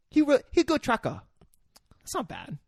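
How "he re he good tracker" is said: put-on very high Karen-accented broken English; imitated Karen rebel accent. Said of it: "what he sounded like".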